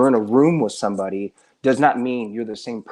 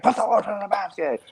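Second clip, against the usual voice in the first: higher, eerie echo of the villain line; friend's villain impression; 10 semitones higher than usual. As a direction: repeat it in a creepy higher voice. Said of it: "And then Dobby's like".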